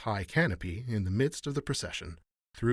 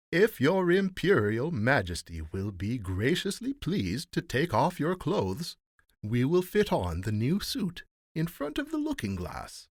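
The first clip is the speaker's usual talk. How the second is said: obsequious rogues; 7 semitones higher, 5 dB louder than usual. A narrator's reading. Rogues asking the emperor to undress, obsequious. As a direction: invite with elaborate, loud deference.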